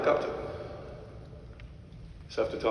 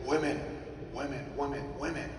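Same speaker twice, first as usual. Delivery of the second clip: imitating dad; 7 dB quieter than usual. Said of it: "my dad would say".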